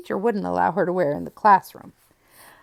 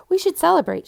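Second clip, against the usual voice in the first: eager; 10 semitones higher, 8 dB louder than usual. A narrator's reading. Claudia proposes a party.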